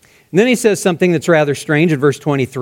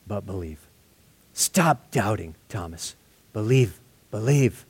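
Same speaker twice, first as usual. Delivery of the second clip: softer, lower, tender reassurance; gracious and gentle; 7 semitones lower, 9 dB quieter than usual. Acted. Jesus inviting doubting Thomas to touch him, gently. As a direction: coax him gently, like a friend. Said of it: "he's so gracious to him".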